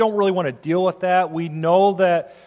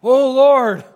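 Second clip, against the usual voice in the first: higher, louder plea to the heavens; raised-voice cry of appeal; crying out to God; 6 semitones higher, 7 dB louder, much slower than usual. Acted. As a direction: call out to the Lord, voice lifted high. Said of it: "He's crying out to him".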